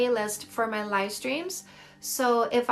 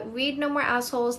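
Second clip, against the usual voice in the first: ordinary talk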